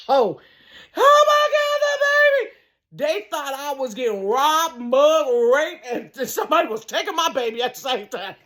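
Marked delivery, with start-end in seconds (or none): 0.1-2.5 high, loud panicked scream; screamed and hollered. Retold or imitated. Mother retelling her scream on the steps, high loud shriek. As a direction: shriek it for the baby. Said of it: "I screamed and hollered".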